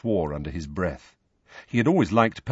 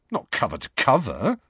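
higher-pitched, mildly defensive reply; defensively; 6 semitones higher than usual. A narrator's reading. Harry admits skimming the paper to Hermione, defensively. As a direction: answer a little on the defensive. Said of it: "said Harry defensively".

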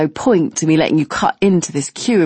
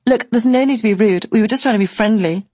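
higher, indignant woman; piping up offended; 5 semitones higher than usual. Retold or imitated. The blonde objects to rudeness, high and indignant. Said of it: "Blondie suddenly pipes up".

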